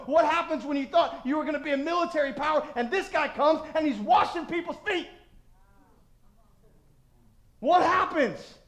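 high, driving preacher's voice; loud, urgent pulpit delivery; impassioned preaching. Real, not acted. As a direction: hammer out each challenge. Preaches at full force.

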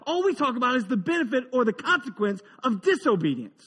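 ordinary talk, nothing marked

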